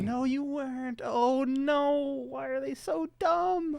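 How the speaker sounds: high, drawn-out lament; slow, high-pitched groaning disbelief; exasperated dismay. Real, not acted. Dismayed at characters on screen.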